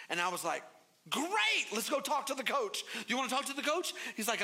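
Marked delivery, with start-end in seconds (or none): 1.1-3.9 put-on a dad pumping up a nervous kid; higher overeager dad cheer; forced cheer. Dad's forced cheer in story.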